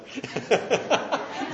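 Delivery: short breathy laugh, rising. Real, not acted. Teacher laughing after calling Jessica Phyllis, breathy laugh. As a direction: laugh at his own slip.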